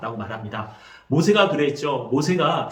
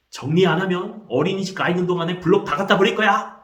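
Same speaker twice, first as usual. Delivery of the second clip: acted parent scolding, higher and louder; angry threat; 5 semitones higher, 5 dB louder than usual. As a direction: threaten the child like a cross parent. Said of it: "아이를 위협하고 아이에게 화를 내는". (화내며 위협하는 부모 흉내)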